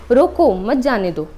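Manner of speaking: रोको stretched and firm, pause, then मत जाने दो; stress on रोको. Shown as a demonstration. Teacher demonstrates the comma, drawing out रोको, then saying मत जाने दो together. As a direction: hit रोको and break before मत. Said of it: "रोको हम लोग बोल रहे हैं तेज से".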